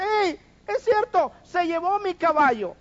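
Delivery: high, loud indignant agreement; accusing shout. Acted. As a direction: back up the accusation loudly.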